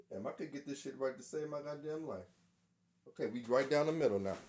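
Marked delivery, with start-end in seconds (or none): 0.1-2.2 much softer, grumbling under the breath; soft exasperated grumble; frustrated mutter. Real, not acted. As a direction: mutter it to yourself, fed up.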